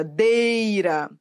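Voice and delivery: "Deira" with a long DEI; emphatic drawn-out "Deira"; stressed syllable demo. Shown as a demonstration. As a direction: lean on and stretch DEI in "Deira". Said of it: "É a sílaba que manda na palavra".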